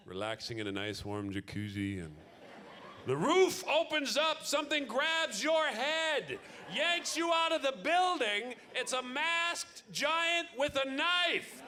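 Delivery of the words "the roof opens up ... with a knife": horror story telling. Acted. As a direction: tell it like a slasher film from the baby's view. Horror of being born by cesarean.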